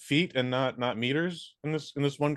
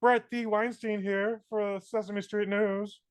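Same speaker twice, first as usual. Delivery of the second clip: muppet newsreader; 10 semitones higher, slower than usual. Pretend. Mock puppet news intro.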